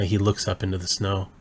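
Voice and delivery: normal talking voice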